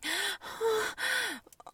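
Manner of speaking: high, sudden gasp. Acted. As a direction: catch your breath in surprise. Sharp intake of breath.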